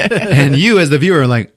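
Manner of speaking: ordinary delivery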